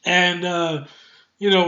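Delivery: ordinary delivery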